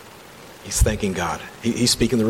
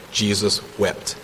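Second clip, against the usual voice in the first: slowed right down, reverent; quiet, unhurried scripture moment; solemn slow reading; 3 dB quieter, slower than usual. Acted. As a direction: linger on both words. Repeats shortest verse solemnly.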